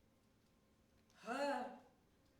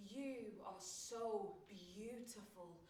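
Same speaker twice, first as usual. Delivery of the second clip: tender compliment; 5 semitones lower, 8 dB quieter, slower than usual. Retold or imitated. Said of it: "tell me".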